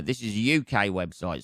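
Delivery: usual speaking voice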